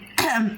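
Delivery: rough throat clear. Real, not acted. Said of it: "Sorry for my throat".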